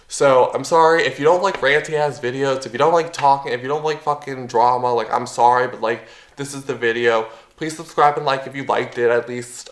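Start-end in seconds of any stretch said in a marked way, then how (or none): none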